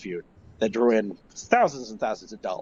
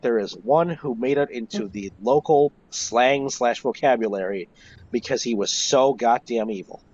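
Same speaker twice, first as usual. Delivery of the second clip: normal talking voice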